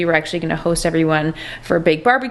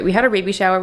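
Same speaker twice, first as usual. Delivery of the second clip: ordinary talk, nothing marked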